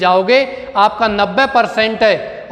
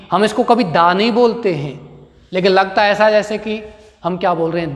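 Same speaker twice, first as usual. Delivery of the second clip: ordinary delivery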